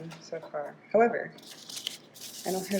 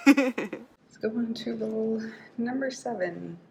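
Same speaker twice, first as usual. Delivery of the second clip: slow, laughing through the words; amused, laughing while saying it; 2 semitones higher, slower than usual. Real, not acted. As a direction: giggle your way through the restart. Retrying intro after flub.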